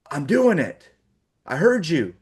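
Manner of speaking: irritated retort. Retold or imitated. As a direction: bite back like a fed-up older brother. Annoyed brother snaps at mom.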